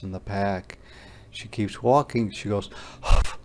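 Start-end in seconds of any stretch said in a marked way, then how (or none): 3.0-3.4 heavy, whispered huffs; two winded, breathy puffs; out of breath. Acted. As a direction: puff as if exhausted from walking. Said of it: "hard for her to catch her breath".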